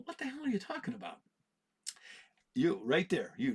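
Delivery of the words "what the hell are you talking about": put-on quick, high, teasing kid; fast high imitation of his kid; mock kid voice. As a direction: toss it off like a cheeky kid. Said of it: "My kid's over there going".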